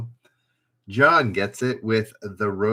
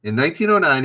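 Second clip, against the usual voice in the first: usual speaking voice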